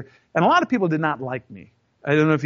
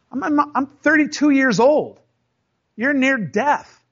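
heated retort; 7 semitones higher, 4 dB louder, slower than usual. Retold or imitated. Heated retort to older boss.

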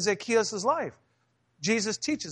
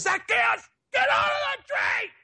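put-on little kid yelling. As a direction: yell it like a kid playing Jesus in the skit. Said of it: "he goes".

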